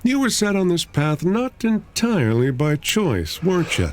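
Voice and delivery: high, soft, breezy voice; a dreamy outsider talking softly; soft distinctive voice. Acted. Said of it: "in a distinctive voice".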